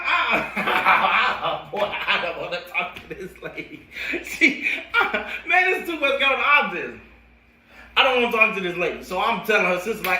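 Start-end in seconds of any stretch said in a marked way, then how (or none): 0.0-7.0 laughing. Real, not acted. Laughing at the memory.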